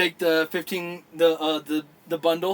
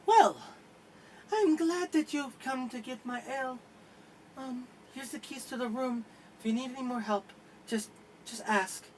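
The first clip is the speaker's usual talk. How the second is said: higher, softer flirty voice; shy barmaid; 9 semitones higher, 6 dB quieter than usual. Acted. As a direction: hand him the keys shyly, almost hushed. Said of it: "And she goes".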